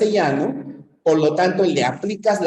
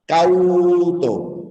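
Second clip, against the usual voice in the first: "cauto" with a drawn-out "cau", then "to"; syllable boundary shown. Shown as a demonstration. Teacher giving a vowel then consonant example, split syllables. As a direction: stretch "cau" in "cauto" before a separate "to". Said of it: "empieza en consonante".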